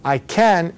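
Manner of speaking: "can" with a clear, open A; stressed "can", not a schwa; full-vowel can. Shown as a demonstration. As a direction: say "can" unreduced, vowel fully sounded. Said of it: "people are not gonna say that".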